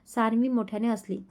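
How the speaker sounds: usual speaking voice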